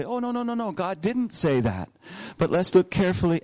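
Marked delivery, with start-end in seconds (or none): 0.0-1.9 mock protest. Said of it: "Eve would come back and say".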